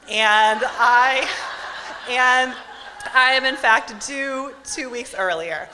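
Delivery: loud, high and laughing, mocking the doctor; sarcastic mock. Mocking the OB who doubts her dates, loud and laughing. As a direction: parody the doctor's logic with a laugh.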